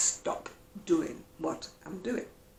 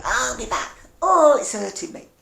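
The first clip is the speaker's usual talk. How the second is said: higher, louder whiny mock complaint "Oh, my back"; mock whining; 4 semitones higher, 9 dB louder than usual. To viewers, mocking people who moan all day. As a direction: parody a self-pitying moaner. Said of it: "I wouldn't go around going".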